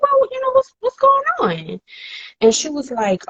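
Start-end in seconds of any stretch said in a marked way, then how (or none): 0.0-1.8 high, put-on innocent voice; mock innocent. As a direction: play dumb in a high sweet voice. Acting innocent with cousin.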